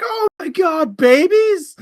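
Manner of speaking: high, squeaky shocked cry; hammed-up horror at the news; mock shock. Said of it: "Carl was like".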